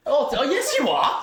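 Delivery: high, loud laughing gasp of surprise. Real, not acted. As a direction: burst out amazed and amused. Teasing surprise at age.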